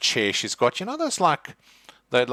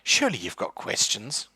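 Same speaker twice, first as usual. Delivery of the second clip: a hint of impatient old lady; 5 semitones higher, 4 dB louder, faster than usual. Agatha prods the player.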